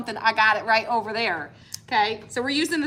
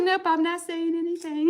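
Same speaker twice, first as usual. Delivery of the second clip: mock husband; 7 semitones higher than usual. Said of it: "He's just like".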